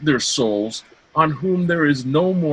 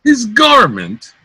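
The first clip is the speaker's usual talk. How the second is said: much higher, louder, incredulous rage; enraged, escalated repeat of the insult; furious repeat; 9 semitones higher, 8 dB louder than usual. Acted. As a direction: shriek it back, beside himself. Cloten repeats, more enraged.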